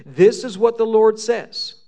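loud, high prophetic declaration; emphatic. Retold or imitated. Jeremiah declares God's word to exiles, emphatic on "This is what the Lord says". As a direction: proclaim "This is what the Lord says" forcefully throughout. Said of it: "this so emphatically".

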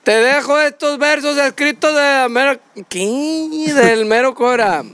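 measured declaimed verse, phrase by phrase; mock poem recital. (declamación poética cómica, frase por frase)

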